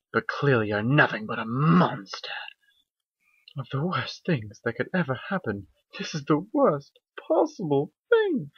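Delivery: cold, scornful whisper of an elegant mare; haughty lady pony. Acted. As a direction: sneer it down at a friend. Rarity voice, cruel lines.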